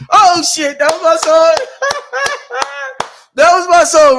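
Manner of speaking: high, loud excited laughing. Real, not acted. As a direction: burst out thrilled after the song. Excited after singing.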